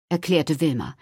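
ordinary delivery

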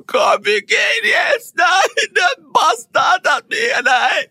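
high, crying and shouting. Retold or imitated. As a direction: wail it through tears, desperate to leave. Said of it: "eine Frau voll verweint".

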